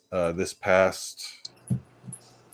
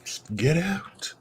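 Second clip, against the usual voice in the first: higher, drawn-out "get out"; mock exasperation; 10 semitones higher, much slower than usual. Acting out being woken by the cat, slow mock exasperation. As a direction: plead slowly with the pest to go. Acted reaction to noisy cat.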